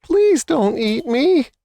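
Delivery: frightened mouse pleading, very high; squeaky mouse. A narrator's reading. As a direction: beg for your life in a tiny squeak. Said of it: "squeaked the mouse".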